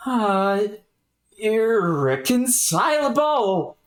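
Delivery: slow, drawn-out lament; lightly voiced distraught character; dismayed character. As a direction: drag out irreconcilable in disbelief. Character laments changed personality.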